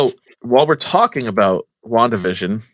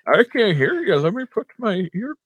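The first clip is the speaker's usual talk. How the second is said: put-on deaf old man; 9 semitones higher than usual.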